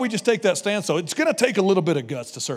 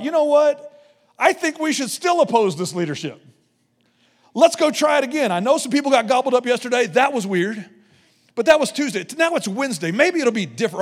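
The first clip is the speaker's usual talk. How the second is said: higher, louder cheeky plotting; mock rebel scheming; 5 semitones higher, 3 dB louder than usual. Rebels urging each other to oppose Moses again, cheeky and raised. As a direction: play the rebels as cheerfully oblivious.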